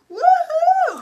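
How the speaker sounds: high, loud celebratory whoop; excited whoop. Real, not acted. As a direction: cheer it out loud. Cheering on livestream.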